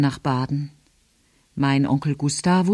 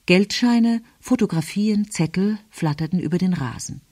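ordinary delivery, nothing marked

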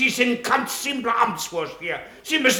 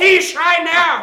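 loud, sharp counter-shout; angry retort; 6 semitones higher, 8 dB louder, much slower than usual. Acted. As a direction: throw the accusation back loudly. Quarrel with the official. (laut zurückgeworfen)

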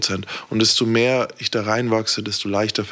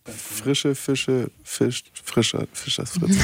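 Zungenbrecher-Übung; 3 semitones higher, 3 dB quieter, slower than usual. Shown as a demonstration. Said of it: "Sprachübung".

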